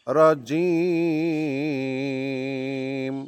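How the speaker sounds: slow, melodic tajweed recitation; Quranic recitation. Shown as a demonstration. Teacher reciting for students to repeat, slow and melodic. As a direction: chant it slowly, drawing out the endings.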